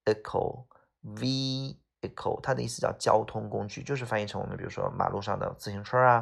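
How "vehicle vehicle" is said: "vehicle" slowly in parts, VEE-i-cle; silent H. Shown as a demonstration. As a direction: draw out "vehicle": long ee, no H, short i. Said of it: "这个H是不发音的".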